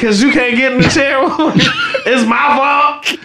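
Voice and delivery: laughing hard while talking. Real, not acted. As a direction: talk through big laughs. Laughing at Tiff falling.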